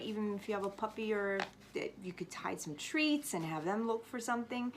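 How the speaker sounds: usual speaking voice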